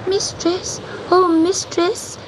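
high, pleading little cat voice; crying kitten. Acted. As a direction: call out to her like a lost kitten. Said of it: "he cried above the storm".

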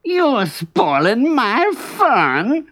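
slow, high fox whine; whining. A narrator's reading. Fox denied his fun, slow high whining. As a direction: draw the complaint out in a high whine. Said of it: "whined Brer Fox".

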